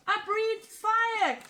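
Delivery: little dragon's high, slow delighted shout; joyful cry. Acted. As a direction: burst out amazed at his own fire. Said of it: "cried the littlest dragon".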